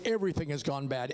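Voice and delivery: ordinary delivery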